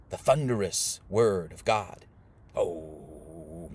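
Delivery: low, slow, booming-word delivery kept soft; thunderous heavenly voice. Acted. Teacher imitating the voice from heaven, low and slow. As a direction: intone it deep and drawn out. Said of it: "a voice came from heaven".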